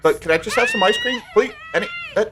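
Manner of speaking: high, shouted whiny begging; pleading bear. Retold or imitated. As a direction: beg like a scolded bear, voice up high. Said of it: "The bear's like".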